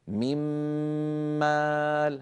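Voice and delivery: "من مال" with a fully stretched nasal double m; drawn-out ghunnah. Shown as a demonstration. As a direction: hum long on the m before "مال". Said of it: "هكذا ننطقها ممطوطة الغنة".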